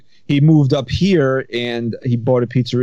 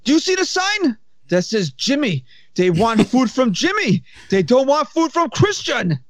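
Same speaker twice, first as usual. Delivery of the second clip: put-on Egyptian accent; 12 semitones higher than usual. Pizza boss scolds the worker about Jimmy, high Egyptian accent. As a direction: mimic the angry boss, pitched way up. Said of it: "And he goes".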